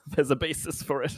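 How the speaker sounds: ordinary delivery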